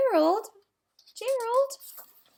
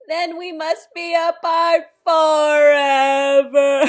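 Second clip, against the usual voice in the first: louder, slow melodramatic wail; hammed-up tragic cry; mock despair; 2 semitones higher, 12 dB louder, slower than usual. Elephant fears losing his pig friend, melodramatic wail.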